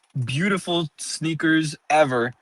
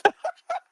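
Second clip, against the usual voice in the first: scary laugh; 4 dB quieter than usual. Real, not acted. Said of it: "That was a really scary laugh".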